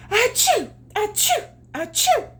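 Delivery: very high, loud fake sneezes; hammed-up hearty sneezing. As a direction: blast out three big achoos. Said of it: "three hearty sneezes".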